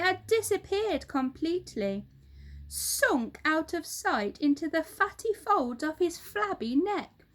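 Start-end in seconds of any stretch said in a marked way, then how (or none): none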